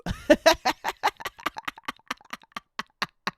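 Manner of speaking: breathless squealing laugh; high squeaky laugh. Real, not acted. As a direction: burst into a high wheezy laugh. Cracks up at the tweet.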